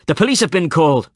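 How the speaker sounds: loud threatening shout. A narrator's reading. Woman outside warning them, shouting. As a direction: yell it through the door. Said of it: "the same woman shouted".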